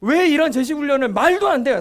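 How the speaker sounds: usual speaking voice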